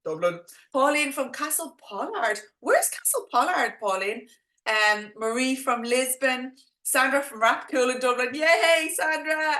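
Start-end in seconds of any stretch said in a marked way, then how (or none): 0.1-0.4 Dublin accent. Shown as a demonstration. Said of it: "do you think I'm very Dublin". 8.3-9.6 excited cheer. Real, not acted. Cheering a viewer by name.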